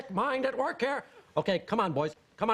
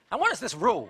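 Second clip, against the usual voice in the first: usual speaking voice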